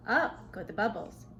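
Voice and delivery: plain reading voice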